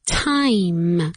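"Time" with a long, firm final m; strong M. Shown as a demonstration. Teacher showing the ending, "Time" with a strong m. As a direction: close hard on the m of "Time". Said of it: "Con una M fuerte".

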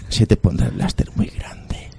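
breathy suggestive whisper. Acted. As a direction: whisper it with a naughty grin. Whispered naughty ad line.

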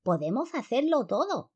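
high lisping cry; grito de polilla. A narrator's reading. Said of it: "gritó otra". (grito agudo y ceceante)

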